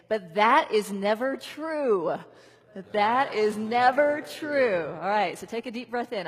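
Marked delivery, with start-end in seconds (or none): 0.1-4.9 loud, slow, emphatic scolding; a playful scold to the doubts; finger-wagging declaration. Shown as a demonstration. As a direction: wag your finger and declare it loudly. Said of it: "kind of fun to kind of shake your finger".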